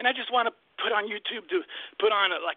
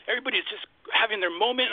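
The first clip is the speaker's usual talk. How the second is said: ordinary talk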